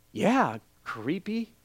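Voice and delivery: slow, quiet, wary mutter to himself; inner creeped-out aside. Retold or imitated. Customer reacting to barista knowing his wife, privately creeped out. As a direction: draw it out softly, privately unsettled. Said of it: "in my mind, I was saying creepy".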